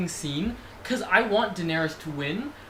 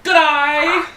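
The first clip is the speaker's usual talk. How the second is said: high, loud Australian greeting; hammed-up Australian accent; 9 semitones higher, 10 dB louder than usual.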